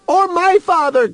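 very high, urgent cry. A narrator's reading. Other piggies echo Human's plea, crying out. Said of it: "cried the other piggies".

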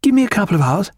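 high, keen reply; eager delight. A narrator's reading. Wife agreeing to leave at once, high and eager. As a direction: answer brightly, pitched well up. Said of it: "with shining eyes".

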